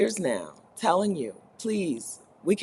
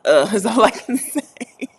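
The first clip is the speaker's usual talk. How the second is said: laughing; 4 semitones higher, 5 dB louder than usual. Real, not acted. Laughing at cold temperature.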